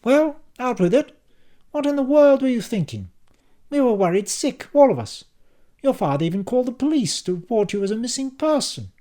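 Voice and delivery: high, loud, indignant mother; scolding mother. A narrator's reading. Mother scolding her son for vanishing. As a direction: scold him like a worried mum.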